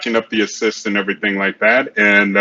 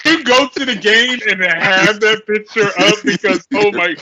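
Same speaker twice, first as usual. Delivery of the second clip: laughing; 9 semitones higher than usual. Real, not acted. Laughing about the photo.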